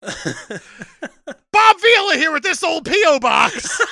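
slow loud pitchman voice; laughing through a pitchman bit; Bob Vila as Billy Mays. Acted. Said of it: "I'm doing Bob Vila like a Billy Mays".

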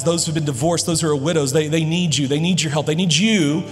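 ordinary talk

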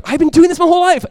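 high, loud, gushing mimicry; exaggerated excited imitation; mock boasting. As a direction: squeal it like a show-off, hammed up. Mimicking an overeager listener.

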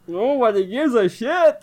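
high-pitched drawn-out jeer; mock heckle. Co-host cutting into the Blizzard news reading, high drawn-out heckle.

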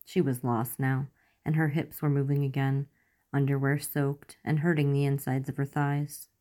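ordinary delivery

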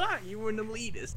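high laughing words. Real, not acted. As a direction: laugh through it. Laughing at own word.

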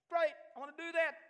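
put-on a touch of boyish overexcitement; thrilled. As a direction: jump at it with wide-eyed delight. Retelling his own eager reply.

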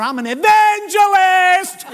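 loud, high, long-held proclamation; spring it on him at full voice; stretched-out triumphant shout; booming announcement. Retold or imitated. Preacher springs his job on man.

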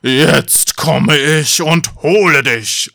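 loud, slow troll threat; troll calling out. A narrator's reading. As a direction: bellow it slowly as a monster. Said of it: "Da rief der Troll".

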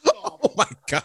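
high squeal of laughter; laughing. Real, not acted. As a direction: laugh through the words. Host cracks up at joke.

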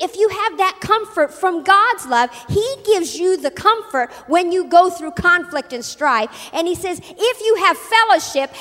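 loud, driving sermon delivery; forceful preaching. Real, not acted.

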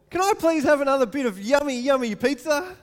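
high, loud, sugary asking voice; sweetly pleading child; polite sweet child. Shown as a demonstration. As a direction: ask nicely like a grateful kid. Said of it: "Do, do you get the difference?".

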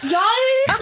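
ordinary delivery

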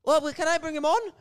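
high-pitched mock Irish woman, asking; high put-on woman's voice. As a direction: imitate her in a high, pleading question. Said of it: "the Irish chick is like".

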